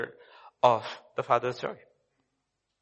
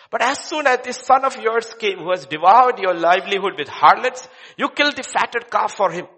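higher, louder, fast and indignant complaint; angry elder son; 7 semitones higher, 7 dB louder than usual. Acted. Preacher acts the elder son complaining to his father, indignant. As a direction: protest like a wronged son.

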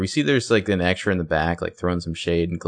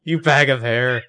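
mock insult; 5 semitones higher, 4 dB louder than usual. Repeating the funny insult.

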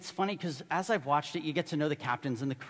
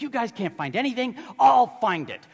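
mock going nuts; 5 semitones higher, 6 dB louder than usual. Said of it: "He goes nuts".

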